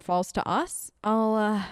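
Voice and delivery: ordinary delivery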